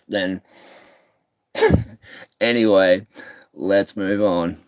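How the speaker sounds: usual speaking voice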